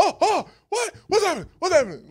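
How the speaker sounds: high, loud mock panic. A man startled awake in the storm, high and loud in mock panic. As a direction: play a man jolted awake in a fake fright.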